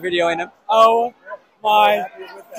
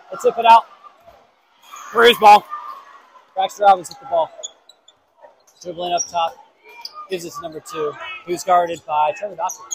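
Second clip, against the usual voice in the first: quiet play-by-play; 5 dB quieter than usual. Acted. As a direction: call the game under your breath. Calling the game live.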